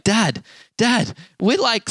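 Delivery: imitated boyish excitement; excited kid. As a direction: mimic an excited son calling for his dad. Son excitedly reports his wins.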